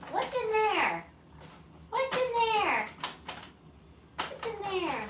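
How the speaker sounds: very high, squeaky-cute repeated question; pet talk. Real, not acted. Owner asking Coco what's in there, high-pitched pet talk. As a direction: coo it high, falling away on the last word.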